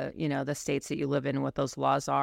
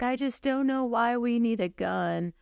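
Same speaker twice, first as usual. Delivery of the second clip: high, drawn-out tearful whine; mock crying; 9 semitones higher than usual. Instructor mimicking anti-gun students at class, mock tearful. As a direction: imitate a student starting to cry. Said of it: "they would just start crying".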